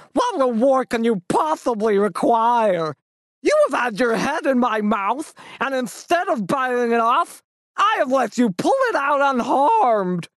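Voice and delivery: menacing greedy wolf scolding. Acted. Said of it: "cried the wolf".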